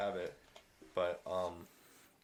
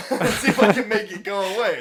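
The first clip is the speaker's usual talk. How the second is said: laughing; 8 semitones higher, 9 dB louder than usual. Retold or imitated. Acting out his own reckless thought.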